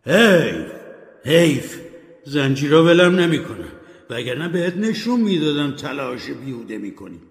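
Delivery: loud, anguished wail of regret; ghost's cry. Acted. Ghost Marley shouts his regret at Scrooge, anguished and loud. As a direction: cry out against the chains. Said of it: "روح فریاد زد".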